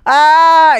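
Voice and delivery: sudden high loud scream as he drops; shocked cry of falling. Retold or imitated. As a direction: let out a yelp of falling terror. Jogger falls off cliff.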